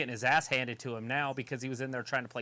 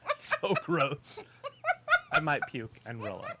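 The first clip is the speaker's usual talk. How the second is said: laughing; 2 semitones higher than usual. Real, not acted. Laughing while disgusted by food.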